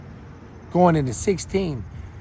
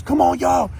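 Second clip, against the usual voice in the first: rally the crowd, shouting; 8 semitones higher than usual. Retold or imitated.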